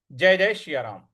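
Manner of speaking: ordinary delivery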